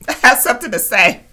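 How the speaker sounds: eager. Retold or imitated. Eager interruption in retold scene.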